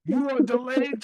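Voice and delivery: high, loud cop barking over a loudspeaker; imitating a state trooper cursing people out; mock trooper yell. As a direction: ham up an angry trooper clearing traffic. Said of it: "They were like".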